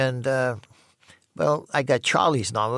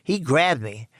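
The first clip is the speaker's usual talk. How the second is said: usual speaking voice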